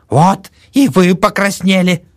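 put-on high, loud gloating old woman; triumphant old woman. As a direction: catch him out gleefully. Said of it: "заметила старуха". (торжествующая старуха)

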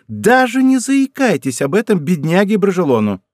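high, loud, urgent warning; urgent cry. A narrator's reading. Montalais forbids telling poor Bragelonne, urgent cry. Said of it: "вскричала Монтале".

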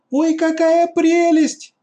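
high, slow, loud delighted squeal. A narrator's reading. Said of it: "вдруг завизжала от восторга Катя".

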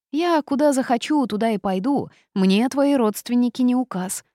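high, loud, cocky retort; indignant defiance. A narrator's reading. Toad refuses to obey the girl's relatives, indignant. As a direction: raise the pitch and volume, refusing orders.

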